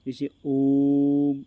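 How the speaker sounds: ओम drawn to triple length; prolonged ओम. Shown as a demonstration. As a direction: stretch ओम out like a chant. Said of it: "स्वर का उच्चारण अपन तीन बार करते हैं".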